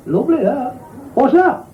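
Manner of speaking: high, amazed woman's voice; a northern woman marvelling at the cab; wide-eyed woman. Acted. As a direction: play her gobsmacked and high.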